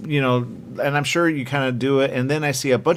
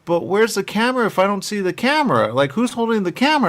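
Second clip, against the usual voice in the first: higher-pitched, mock-baffled mother; confused mother; 6 semitones higher than usual. Host quoting mother caught up in the movie, mock bafflement. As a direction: imitate a mother puzzled by the film. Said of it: "she literally got so confused".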